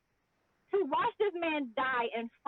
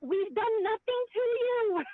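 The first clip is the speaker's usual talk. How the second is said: mock outrage; 10 semitones higher, 5 dB louder than usual. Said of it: "the real anger was just like".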